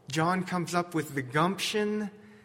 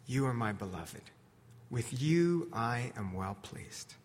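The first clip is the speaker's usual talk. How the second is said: low, soft, measured blessing; quiet, tender pronouncement; tender God; 4 semitones lower, 6 dB quieter than usual. Acted. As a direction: lower the voice and affirm gently. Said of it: "here was the voice of God saying".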